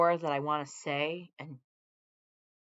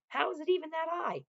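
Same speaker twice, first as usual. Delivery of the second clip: incredulous; 12 semitones higher than usual. Real, not acted. Repeated disbelief, pitch up.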